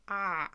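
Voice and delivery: "ah" with the tongue pulled back too far. Shown as a demonstration. Teacher warns learners about er, retracted "ah". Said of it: "If you pull too far".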